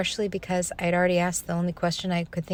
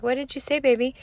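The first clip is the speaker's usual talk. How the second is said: put-on high, lifted question to her baby; imitating a mom's insistent baby-talk; mother to toddler; 6 semitones higher, 4 dB louder, faster than usual. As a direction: coax an answer from a small child. Mother asks toddler again, retold.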